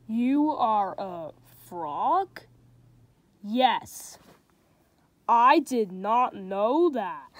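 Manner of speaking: slow storybook reading with light character voices; reading to children. A narrator's reading. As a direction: read it like a bedtime picture book. Reads a picture book aloud.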